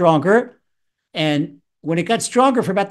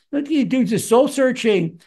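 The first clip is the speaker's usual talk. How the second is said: ordinary talk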